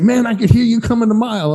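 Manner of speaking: high amused voice; amused stranger. Retold or imitated. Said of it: "He's like".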